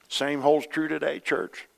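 usual speaking voice

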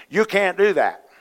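mimicked objectors. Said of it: "people over the years going".

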